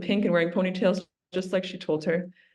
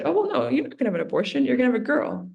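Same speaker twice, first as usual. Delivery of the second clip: higher-pitched, quick mock objection; retold spicy comeback, pitched up; spicy retort; 6 semitones higher, faster than usual. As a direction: lightly imitate a spicy woman overruling someone. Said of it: "she was very spicy".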